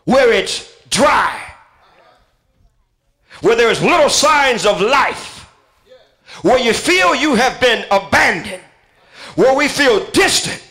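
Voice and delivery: building, rising preacher's cry; swelling sermon climax; full-force preaching. Acted.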